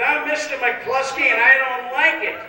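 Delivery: high, loud, cracking up; indignant husband barely holding a laugh. Acted. Husband McCluskey complaining, loud and laughing.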